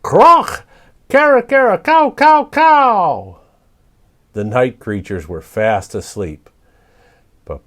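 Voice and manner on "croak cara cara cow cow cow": high, loud parrot calls; squawking like a raucous parrot. A narrator's reading. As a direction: screech out a morning bird call. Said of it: "greeted the morning with a raucous".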